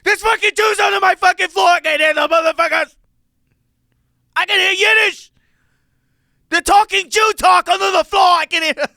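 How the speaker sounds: high-pitched screaming rant; full-volume, very high ranting impression; Brian Holtzman. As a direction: shriek it at the top of your voice, outraged.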